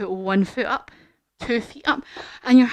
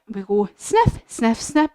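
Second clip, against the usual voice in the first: sniffing demo. Shown as a demonstration. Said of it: "Can you do some sniffing?".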